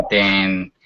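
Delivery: usual speaking voice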